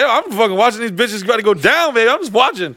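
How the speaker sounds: cheeky hype. Retold or imitated. Brushing off security.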